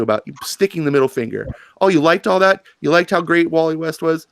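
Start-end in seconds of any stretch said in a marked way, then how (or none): 1.8-4.3 sarcastic mockery. Mocking the writers' attitude.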